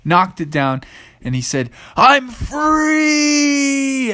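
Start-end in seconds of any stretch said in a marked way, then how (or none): 2.0-4.1 long, high cry of freedom; hammed-up triumphant dinosaur yell; exultant shout. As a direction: holler it as a freed T-Rex. T-Rex breaks out of cage.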